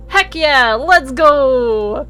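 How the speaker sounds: loud happy cheer; excited cheer. Real, not acted. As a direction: celebrate the unlock. Celebrating unlock.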